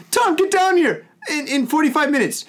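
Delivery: high-pitched mock plea to a friend; mock urgent call. Teller acting a phone call to his friend, mock urgent. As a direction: call out high, half laughing. Mimics calling Tom on phone.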